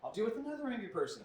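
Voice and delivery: ordinary talk, nothing marked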